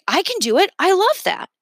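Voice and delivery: high, bright-eyed eager yes; excited boy voice; eager kid. A narrator's reading. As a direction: jump at the chance. Mason eager reply.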